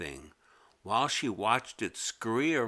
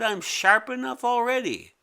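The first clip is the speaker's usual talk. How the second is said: much higher indignant creature voice; the creature protests, voice pitched up high; squeaky creature; 12 semitones higher, 5 dB louder than usual. Acted. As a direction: squeak up in alarm.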